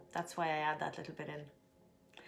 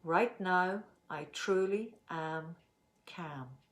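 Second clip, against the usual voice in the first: slow calm affirmation; much slower than usual. Shown as a demonstration. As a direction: say it slowly, letting calm settle. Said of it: "let's make our statement".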